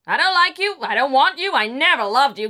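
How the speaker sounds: high, loud rejecting shouts; yelling mother. Acted. Drunk mother screaming at her son: cruel shouts. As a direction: hurl each line at him. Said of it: "She then yells at him".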